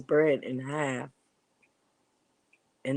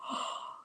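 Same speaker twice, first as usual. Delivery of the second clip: shocked gasp; 9 dB quieter than usual. Retold or imitated. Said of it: "And I go".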